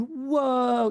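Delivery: long, high, drawn-out "whoa"; amazed audience reaction. Retold or imitated. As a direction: imitate a whole crowd impressed. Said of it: "everyone in the audience was going".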